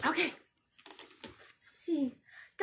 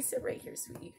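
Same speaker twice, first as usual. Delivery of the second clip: soothing dog; 9 dB quieter than usual. Real, not acted.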